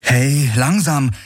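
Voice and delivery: high, drawn-out call. A narrator's reading. Kirai sees Hawks struggle to his mount, called out. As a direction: call out to slow him down. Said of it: "rief sie".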